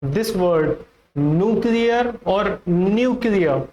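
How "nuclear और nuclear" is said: first "न्यूक्लियर" as NOO-klee-ar, second as NYOO-klee-a; two rival pronunciations. Shown as a demonstration. Teacher asks which pronunciation is right, contrasting both. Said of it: "What could be the pronunciation".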